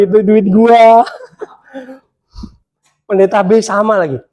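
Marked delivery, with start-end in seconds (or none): 0.0-1.1 slow, grudging mock complaint; grudging aside. Preacher joking about his inner grudge over the gift, slow mock complaint. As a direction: mutter that it was your money, half laughing. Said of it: "Dalam hati".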